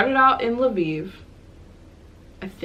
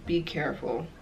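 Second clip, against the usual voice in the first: whisper; 2 semitones lower, 9 dB quieter than usual. Retold or imitated. Clerk's quiet warning, retold.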